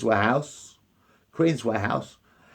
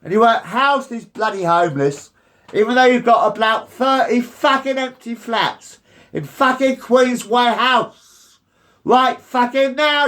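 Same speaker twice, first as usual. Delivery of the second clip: angry shout; 3 semitones higher than usual. Real, not acted. Shouting at council.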